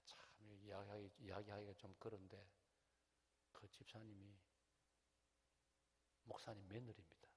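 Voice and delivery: quiet, low, hesitant confiding with long pauses; reluctant confiding. Retold or imitated. Elder confiding a family secret to the pastor, hushed and reluctant. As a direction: break delicate news softly, haltingly.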